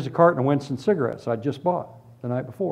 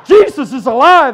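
joyful shout; 10 semitones higher, 10 dB louder than usual. Retold or imitated. New believer shouting up from his knees, loud and joyous. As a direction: shout the news with joy. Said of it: "I was".

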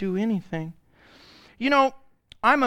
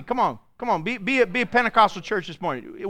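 normal talking voice

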